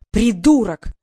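loud, furious insult; enraged shout. A narrator's reading. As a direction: shout it while struggling to break free. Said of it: "кричал разъярённый Норт".

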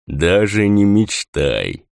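put-on slow, creaky old spirit. A narrator's reading. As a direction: drag it out like a creaky ghost. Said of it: "скрипучий голос".

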